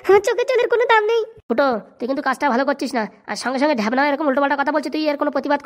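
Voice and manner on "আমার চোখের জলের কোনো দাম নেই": higher, whining wife protesting; whiny wife. Acted. Wife complaining to her husband about Phuto, high whine. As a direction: play the wife, aggrieved and shrill-pitched high.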